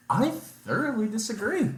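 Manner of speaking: high-pitched, prim protest; mock indignant objection. Patient answering tester who said it wasn't so bad, mock indignation.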